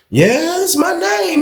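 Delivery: sudden high, thin nervous voice; voice cracking high. Retold or imitated. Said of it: "His voice changed and everything".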